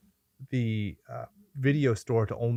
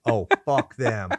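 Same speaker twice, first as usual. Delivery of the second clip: slow, drawn-out mock cursing; mock angry outburst; slower than usual. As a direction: curse the studio with exaggerated, stretched-out words. Mock outburst at the disliked studio.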